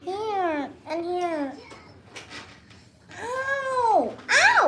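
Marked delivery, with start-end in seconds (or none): 3.2-4.6 wail, then yelp in pain. Real, not acted. Child being tended, pained wails.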